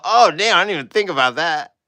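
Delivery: high, loud realizing oh damn; loud, high surprised oh damn; mock surprise. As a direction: caught out and amazed. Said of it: "I was all".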